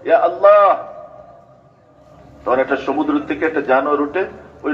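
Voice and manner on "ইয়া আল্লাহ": louder, higher drawn-out cry imitating a desperate plea; imitated cry out. Preacher voicing a mother whose baby was thrown into the sea, imitated cry out. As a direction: call out to Allah like the woman in the tale, voice lifted. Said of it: "মহিলা চিৎকার করে বলছে".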